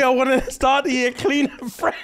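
put-on lightly done Nigerian mum accent. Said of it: "I couldn't hear that in a Nigerian accent".

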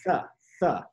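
"th" with tongue between teeth; th sound. Shown as a demonstration. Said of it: "tenemos que pronunciarlo como th".

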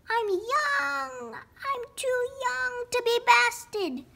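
high, drawn-out protest; desperate wail. A narrator's reading. Runt hides behind Turk, high desperate wail. Runt pleads for his life.

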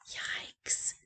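whispered yikes. Real, not acted. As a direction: whisper it like a secret. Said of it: "reader aside".

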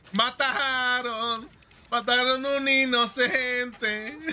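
laughing through the words; giggling while talking. Real, not acted. Laughing at teammate's death.

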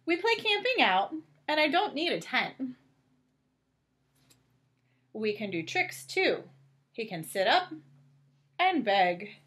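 lightly animated storybook narration; reading to children. A narrator's reading. Reading Clifford aloud to a child, gentle storybook voice. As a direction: read it out gently to little ones. Reads picture book aloud.

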